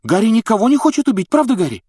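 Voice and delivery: high, fast, frightened protest. Acted. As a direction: rush it out in alarm. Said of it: "испугалась Гермиона".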